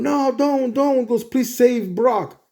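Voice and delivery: a touch of panicked pleading, the last plea slow; retold pleading. Venom begging Spider-Man not to fire, mild pleading.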